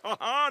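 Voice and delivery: mocking laugh.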